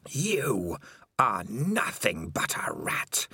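mock him cruelly, slowly; sneering taunt. Spider taunts Dangerous Beans.

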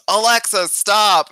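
high, loud order to device; loud command. Acted. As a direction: bark the command over the music. Commanding the speaker over music.

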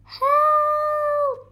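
long, high, loud wail; high-pitched drawn-out plea; cry for help. A narrator's reading. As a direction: cry out, drawing the word out. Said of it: "came a cry again".

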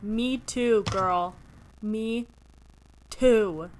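loud, drawn-out agreement with the heroine; emphatic fellow feeling. Acted. Reader answering the book's heroine, loudly emphatic. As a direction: punch each word out with big empathy.

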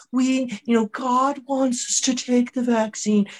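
high, slow, sanctimonious voice; mock preachy governor. Governor telling the church crowd about God, mock pious.

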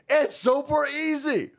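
normal talking voice

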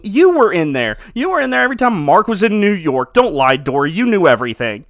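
loud, emphatic cross-examination; hammed-up indignant accusing; mock accusation. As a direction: call her out as a liar. Mock accusation addressed to absent Dory.

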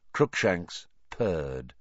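usual speaking voice

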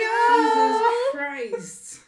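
high, loud, drawn-out cry; exasperated outburst. Retold or imitated. Fan reacting to relating to a character, loud drawn-out outburst. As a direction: wail it out slowly in exasperation.

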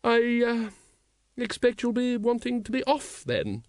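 high, halting ladylike voice; high-voiced woman fumbling a goodbye; awkward lady. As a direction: falter at the start, pitched well up. Lady Ramkin's awkward parting.

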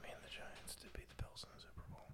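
breathy stage whisper. Pretend. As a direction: whisper it like a secret. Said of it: "Why are we whispering?".

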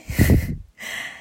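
short unvoiced airy laugh; breathy laugh. Describing how much she laughs, a breathy giggle. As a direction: let a quick breathy giggle slip out.

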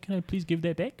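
put-on polite plea in a light New Zealand accent; sweet New Zealander. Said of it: "this fucking sweetheart of a guy".

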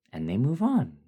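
ordinary delivery, nothing marked

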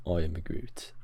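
breathy whispered "I am Groot". Pretend. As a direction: breathe it like a tree creature. Echoes Groot quote.

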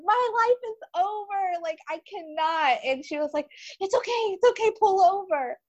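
retold panic in a high tearful voice; crying. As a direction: voice cracking with tears. Retelling panicked call, crying.

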